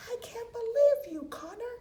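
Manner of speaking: high, loud mock exasperation; exasperated mom. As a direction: cry out in disbelief.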